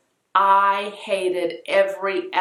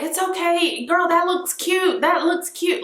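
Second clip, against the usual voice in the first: ladies reassuring her; 10 semitones higher than usual. Retold or imitated.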